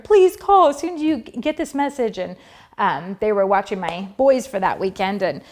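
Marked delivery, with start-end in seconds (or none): 0.0-2.2 high-pitched imitated pleading; retold frantic urging; frantic stepmom. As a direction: plead like a worried stepmom on voicemail. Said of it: "Just totally frantic".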